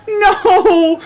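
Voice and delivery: high wailing no. Acted. Mia wails as Kenya kisses Ivana, high and despairing. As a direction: let out a high despairing wail. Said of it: "Mia wailed".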